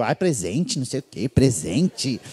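low, soft, drawn-out words; lightly voiced mother. Son imitating mother receiving a present, low soft drawl.

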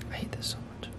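frustrated whisper. Real, not acted. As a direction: whisper it under your breath. Quiet frustrated aside.

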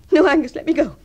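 loud, urgent plea to be released; loud protesting refusal. Acted. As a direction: raise the voice sharply to break free. Pushing a lover away.

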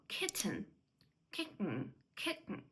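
"kitten" with a glottal stop in the middle. Shown as a demonstration. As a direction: catch the t in "kitten" in the throat. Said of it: "Stop T". ("kitten" з гортанною зупинкою)

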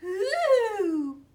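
high, loud, eerie howl; drawn-out ghostly cry; icy monster call. A narrator's reading. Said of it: "an icy voice called".

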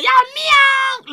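high-pitched, drawn-out mock meow; mimicked meow. Reactor repeats the rapper's lyric, high mock meow. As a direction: lift it high and stretch the meow. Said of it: "He's like".

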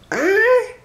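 high squeaky astonished huh; high disbelieving huh; shocked huh. Acted. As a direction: sound utterly baffled. Shocked at tiny engine.

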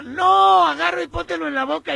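high, loud-starting mock shout; reenacted yelling back. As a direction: call the instructions through a wall. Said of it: "me gritan del otro lado".

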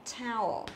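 towel said with a long "ao" glide; teacher's clear model of "towel"; model pronunciation. Shown as a demonstration. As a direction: draw out "towel" to show the ao sound. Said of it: "nó cũng là âm ao".

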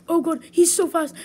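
panicked yelp, loud and high; terrified horror victim. Real, not acted. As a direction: scream it as he chases you. Chased by Huggy Wuggy.

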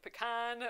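"pecan" as puh-KAHN, slowly; others' pronunciation. Shown as a demonstration. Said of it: "whenever I hear anyone else say it".

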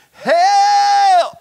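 high, loud shout of help; desperate cry for help. Pretend. Fish hear Jonah cry from the belly, shouting. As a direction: yell for help from inside the whale.

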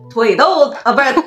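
ordinary talk, nothing marked

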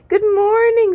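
high, loud sing-out good morning; big, high-pitched morning call; exuberant greeting. A narrator's reading. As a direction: belt out a cheery greeting.

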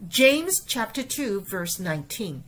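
整句示范朗读; model reading. Shown as a demonstration. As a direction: read the full verse through with feeling. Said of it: "我们整句来过".

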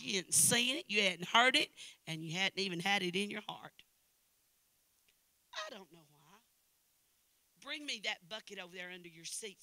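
ordinary talk

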